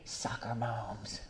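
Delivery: soft slow muttered aside; muttered first time. Real, not acted. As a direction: say it under your breath first. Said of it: "In case you didn't hear me the first time".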